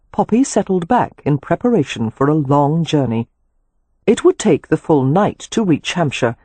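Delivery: usual speaking voice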